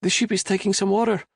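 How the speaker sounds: put-on high, anxious boy's voice; nervous cabin boy blurting bad news; frightened stammer. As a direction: cabin boy reporting damage to his captain, scared. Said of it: "Enrique stammered".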